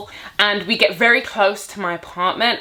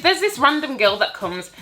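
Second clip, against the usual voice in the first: ordinary delivery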